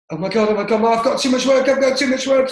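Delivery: high, loud mock panic. Imitating himself panicking over too much work, high and hammed-up. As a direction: ham up the overwhelmed worrier. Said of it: "it'd go like this".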